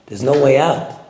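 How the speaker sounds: usual speaking voice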